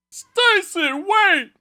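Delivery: very high, loud put-on call; imitating the movie guy. Mocking the guy calling after his leaving girlfriend, high squeal. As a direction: squeal the name after the departing girl.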